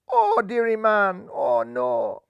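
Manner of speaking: slow, odd-voiced wail of dismay; a lightly voiced spectator crying oh no; Caribbean dismay. Spectators fall from tree.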